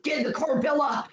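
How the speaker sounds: hammed-up urgent rescue command; Edward Cullen. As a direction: play the dramatic rescuer quoting a film. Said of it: "drives his car there and is like".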